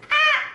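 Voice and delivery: very high, loud imitated baby wail; newborn's first cry. Father imitating his son's first cry, high and loud. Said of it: "he goes".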